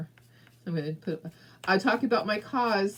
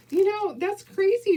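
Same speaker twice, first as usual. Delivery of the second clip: sudden high, loud disbelief; incredulous outburst; 13 semitones higher, 6 dB louder than usual. Real, not acted. Telling viewers about pet theft, high incredulous outburst. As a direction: blurt out how shocking it is.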